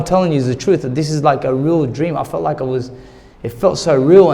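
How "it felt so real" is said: ordinary talk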